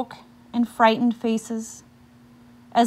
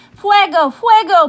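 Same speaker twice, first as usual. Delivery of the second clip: much higher, louder alarm cry of "Fuego"; shouted; 10 semitones higher, 11 dB louder than usual. Acted. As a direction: yell "Fuego" like the house is burning. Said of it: "people shouted".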